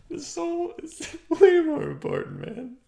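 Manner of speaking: high, giggling through the words; laughing. Real, not acted. Urging viewers toward connection, high laughing.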